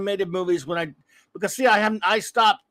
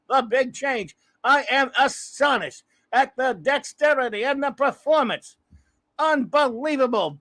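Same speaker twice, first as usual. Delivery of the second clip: Howard Cosell sportscaster; 5 semitones higher than usual. Acted. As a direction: call the poll like a boxing match. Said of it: "my stupid Howard Cosell".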